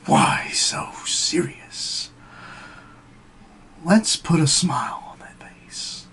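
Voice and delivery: very slow, stretched sinister whisper; Joker. Acted. Joker quoting his father with blade in his mouth, menacing whisper. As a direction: hiss the taunt in a long, drawn-out whisper. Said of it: "And he says".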